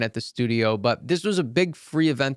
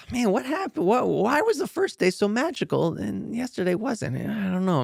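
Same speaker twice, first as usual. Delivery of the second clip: put-on higher, puzzled young student voice; 8 semitones higher than usual. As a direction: wonder aloud why the magic faded.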